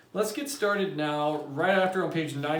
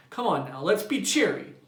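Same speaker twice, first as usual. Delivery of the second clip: high, pleading brightness; forced cheer; 7 semitones higher, 5 dB louder than usual. A narrator's reading. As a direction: beg her to be cheery. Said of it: "begged Mildred".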